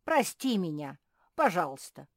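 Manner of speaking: loud, emphatic pleading; impassioned loud plea; fervent apology. Acted. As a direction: beg forgiveness in a rush of shame. Hedgehog begs forgiveness for accusing him.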